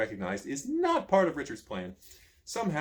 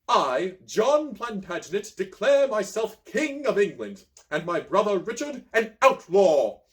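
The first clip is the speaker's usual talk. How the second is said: loud, slow, higher pompous decree; royal proclamation; 6 semitones higher, 7 dB louder, slower than usual. A narrator's reading. Prince John proclaiming to the gathering, loud, slow and pompous. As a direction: declaim every phrase like a herald.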